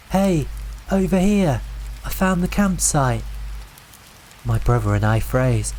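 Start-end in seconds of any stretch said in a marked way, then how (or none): 0.1-3.2 higher, raised eager call. A narrator's reading. The narrator's own voice calling from the dark, eager and higher. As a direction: shout it out like good news. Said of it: "it was my voice, calling from the darkness".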